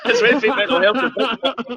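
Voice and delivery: slow, laughing aside. Real, not acted. Joking to his friend about the serious turn, laughing. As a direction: laugh it off, slowly.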